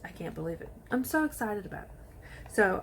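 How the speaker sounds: ordinary delivery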